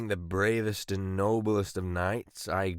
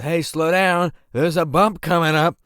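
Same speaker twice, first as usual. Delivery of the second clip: loud, higher warning yell; 8 semitones higher, 9 dB louder than usual. Retold or imitated. Passenger yelling at driver about the bump, loud warning. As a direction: yell a warning over the cart. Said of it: "I yelled".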